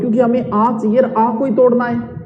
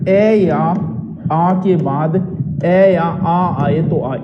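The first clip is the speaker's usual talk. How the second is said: slow rule recitation; 2 semitones lower, much slower than usual. Shown as a demonstration. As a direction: rule stretched out very slowly, vowel by vowel. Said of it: "ये रहा नियम". (नियम बहुत धीरे-धीरे समझाते हुए)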